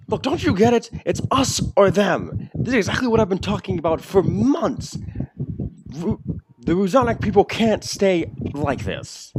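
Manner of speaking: pressing the crowd to take sides; orc demagogue. Acted. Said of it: "as he says".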